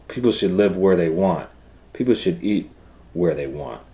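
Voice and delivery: loud, clear storybook read-aloud; reading to children. Acted. Teacher reads the book to her class, loud and clear.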